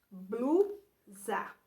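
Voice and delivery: "Blusa" split, with a long pause after BLU; syllables sounded out. Shown as a demonstration. Teacher repeating the example word for pupils, syllables sounded out. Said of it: "Está logo no início".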